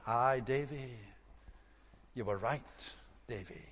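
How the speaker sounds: soft broad Scots, trailing away; quietly, regretfully, in broad Scots; broad Scots accent. Retold or imitated. Preacher repeats the old man's words, soft broad Scots. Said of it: "he would speak very broad Scots".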